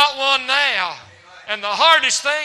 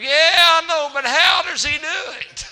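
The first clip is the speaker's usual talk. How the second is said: nosy miser, mocking; 5 semitones higher, 4 dB louder than usual. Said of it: "Daddy said / the old miser".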